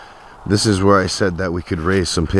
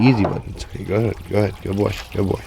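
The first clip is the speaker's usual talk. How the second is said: soothing a scared dog; faster than usual. Real, not acted. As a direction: gently settle a nervous animal. Calming an animal at water.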